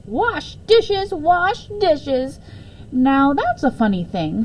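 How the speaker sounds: high, musing wonder at the words; amused surprise. A narrator's reading. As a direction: marvel playfully at the idea. Mrs Piggle Wiggle muses.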